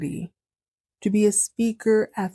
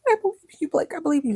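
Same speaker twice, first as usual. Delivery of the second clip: a hint of a sweet, much higher wife; mock Crystal; 9 semitones higher, much faster than usual. Crystal reassuring Blake about the money, lightly voiced. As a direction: coo it at him lightly.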